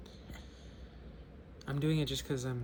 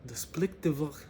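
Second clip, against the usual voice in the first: Mexican attempt slipping into Russian accent; mock Russian-sounding accent. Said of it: "That's Russian".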